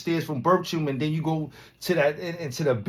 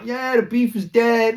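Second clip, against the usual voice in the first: high, slow, smooth mock voice; smooth talker; 10 semitones higher, 7 dB louder than usual. Mocking the guy who lied, smooth and slow. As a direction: imitate his smooth denial. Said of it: "he was just smooth as shit".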